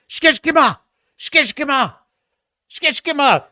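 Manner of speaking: high, urgent film-style name call; imitated movie shout. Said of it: "I think that's how they say it in the movie".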